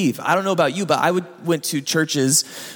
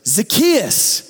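calling out; 5 semitones higher than usual. Acted. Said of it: "and goes".

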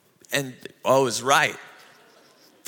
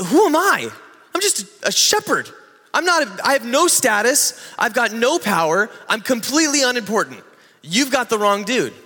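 whiny Moses; 4 semitones higher than usual. Retold or imitated. Moses objecting to God at the bush, high pleading whine. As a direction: beg out of the job like a nobody. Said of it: "His first objection is".

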